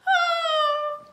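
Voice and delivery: put-on high, loud child's shriek; piercing held squeal; terrified scream. A narrator's reading. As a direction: scream in mock terror. Twins terrified.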